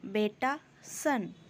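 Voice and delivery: normal talking voice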